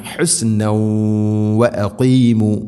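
ഹുസ്നൗ held in a nasal hum into വ; nasal merging. Shown as a demonstration. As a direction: hum the end of ഹുസ്നൗ through the nose into വ അഖീമു. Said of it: "മണിച്ചുകൊണ്ട് ഉച്ചരിക്കണം".

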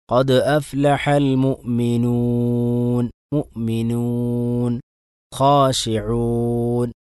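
slow, drawn-out tajweed chant; slow Quran recitation. Shown as a demonstration. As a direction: recite carefully, stretching each vowel.

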